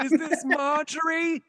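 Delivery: put-on high, slow old lady voice; old lady character. Sisters doing a phone bit, high slow old lady voice. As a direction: quaver it out like a confused granny. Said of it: "she's like".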